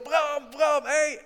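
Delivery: high-pitched mock yell; acted shouting. Shopper spots Bram in the distance, shouting his name. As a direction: call out as if to someone far off. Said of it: "heel hard te schreeuwen".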